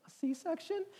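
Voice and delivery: small, high, soft question; imitated heartbroken wife. Wife turning over to her husband, imitated as small and heartbroken. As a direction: imitate a wife's high, fragile little voice. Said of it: "she said it in this voice that just broke me".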